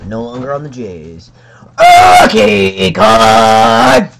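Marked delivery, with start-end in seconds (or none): 2.2-4.1 much higher, very loud card!; big shout. Real, not acted. Announcing each card to the camera, shouting loud. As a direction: bellow it like a game show.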